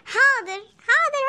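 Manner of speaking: normal talking voice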